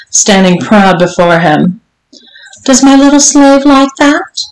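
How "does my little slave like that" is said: high lilting taunt, rising at the end; teasing. Acted. Mistress to her servant, playfully teasing. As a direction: toy with him in a raised, singsong question. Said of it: "Missus Johnson teased".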